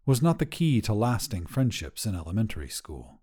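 ordinary delivery, nothing marked

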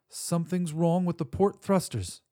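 worried alarm in the voice. Acted. Narrator alarmed at ship failure.

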